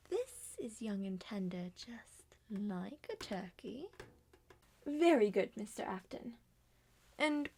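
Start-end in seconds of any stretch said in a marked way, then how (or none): 0.1-3.9 slow, soft, unvoiced menace; sinister whisper. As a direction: whisper it like a gloating killer. Creepy guest describes the meat.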